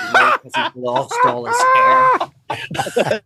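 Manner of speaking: very high, loud, slow shriek of alarm; shrieking gossip. Retold or imitated. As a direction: shriek the terrible news. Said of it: "She goes".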